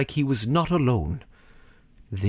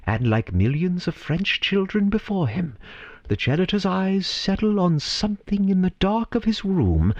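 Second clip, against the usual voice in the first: narration lifting higher as the dream appears; rising storyteller wonder; 6 semitones higher than usual. A narrator's reading. Narrator drawing listeners into the janitor's dream, rising in wonder. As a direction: let the story swell into awe.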